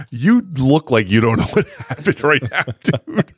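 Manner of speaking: talking through laughter; helpless giggly delivery; laughing. Real, not acted. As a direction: crack up while teasing a friend. Cracking up at high co-host.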